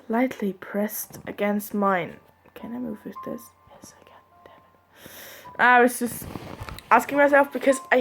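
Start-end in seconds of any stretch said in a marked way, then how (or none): 2.5-5.6 whispering to herself. Real, not acted. Muttering to herself.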